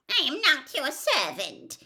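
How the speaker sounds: high squeaky protest. A narrator's reading. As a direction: squeak out the defiance. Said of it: "he squeaked".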